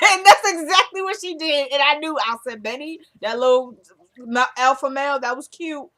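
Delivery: loud, high and laughing recap; rising glee as she calls it; excited. Real, not acted. A viewer cheering on the drama, loud and excited.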